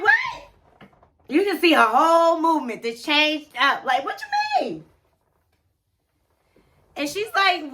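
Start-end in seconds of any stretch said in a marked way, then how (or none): 0.0-0.9 a sudden very high shocked "What?". Retold or imitated. Sarah hearing about six kids, shrill shocked "What?". 4.0-4.8 very high, thin "what you mean?"; stunned disbelief. Retold or imitated. Sarah questioning Sean, very high stunned disbelief. Sarah still reeling from the news.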